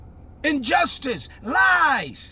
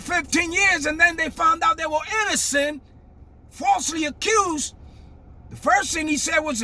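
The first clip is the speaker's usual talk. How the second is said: normal talking voice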